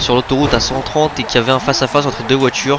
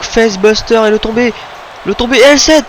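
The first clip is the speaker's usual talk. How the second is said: high, loud commentator shout; excited pin call; 11 semitones higher, 5 dB louder than usual. Real, not acted.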